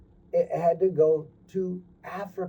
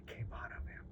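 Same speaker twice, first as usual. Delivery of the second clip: whisper; 22 dB quieter than usual. A narrator's reading. Storyteller whispers the joy.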